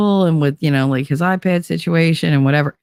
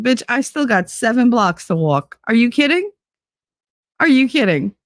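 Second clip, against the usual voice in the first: higher, outraged disbelief; indignant; 7 semitones higher than usual. Retold or imitated. Left to walk seven blocks in labor, higher and outraged. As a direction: throw the question back in outrage.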